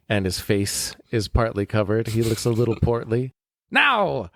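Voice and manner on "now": high, showy trainer voice giving silly advice; pompous swami trainer. Acted. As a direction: play a self-important turbaned coach. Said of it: "man there wearing a turban".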